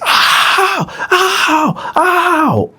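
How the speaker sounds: very high, pained cries; Dylan crying out in pain from Bone's attack; pained scream. Retold or imitated. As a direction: cry out in pain.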